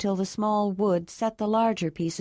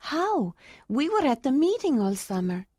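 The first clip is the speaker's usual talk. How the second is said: higher, surprised woman's voice; 6 semitones higher than usual. A narrator's reading. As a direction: ask in happy surprise. Ayla surprised.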